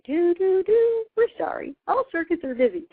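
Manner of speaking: high sing-song imitation of a circuits-busy recording; mock recorded message. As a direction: play the automated phone line, chimes and all. Mimicking a recorded phone message.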